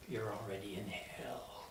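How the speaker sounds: eerie breathy whisper; whispered doom; spooky whisper. Acted. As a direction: hush it like a threat. Ominous line delivered to newcomer.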